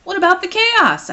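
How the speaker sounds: high, loud demand. Acted. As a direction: insist on an answer. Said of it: "I demanded".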